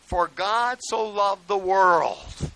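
very slow, loud solemn reading; scripture recitation. Real, not acted. Preacher reciting John 3:16, slow and solemn. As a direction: declaim the verse slowly.

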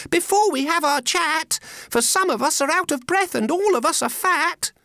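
very high, piping creature voice; little oysters. Acted. Oysters beg a rest before chatting, high and piping. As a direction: plead in a tiny high voice. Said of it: "the oysters cried".

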